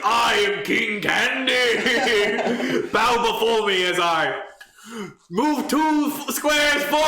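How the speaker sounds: booming high villain king voice; hammed-up tyrant proclaiming; dark lord King Candy.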